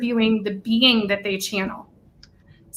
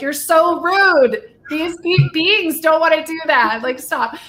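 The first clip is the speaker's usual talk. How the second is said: higher, louder, slow scolding; exasperated telling-off, higher and louder; mock scolding; 6 semitones higher, 4 dB louder than usual. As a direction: scold them for their rudeness. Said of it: "And it's like".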